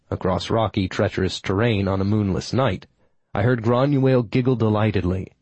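usual speaking voice

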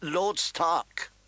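mild, courtly politeness; polite. A narrator's reading. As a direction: greet him politely, pitch lifted. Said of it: "Varys said politely".

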